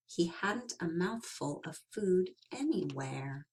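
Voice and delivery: soft unvoiced storyteller whisper. A narrator's reading. Narrator whispering to the children about the empty hollow. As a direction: breathe it like a sad secret.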